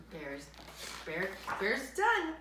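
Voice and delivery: plain reading voice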